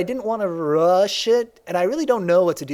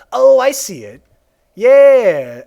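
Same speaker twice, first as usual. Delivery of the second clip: excited; 5 semitones higher, 11 dB louder, much slower than usual. Real, not acted. Recognizing the Plankton likeness.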